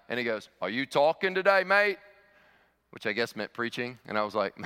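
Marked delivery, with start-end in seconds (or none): 0.6-2.0 put-on raised, punchy Australian accent. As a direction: imitate a big Australian bloke. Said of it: "this big Australian guy".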